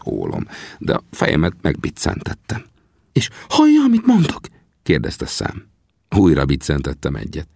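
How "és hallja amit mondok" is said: very high, eager question; high-pitched excited character voice; excited Sam. A narrator's reading. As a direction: check eagerly, high and loud. Excited Sam questions wounded friend.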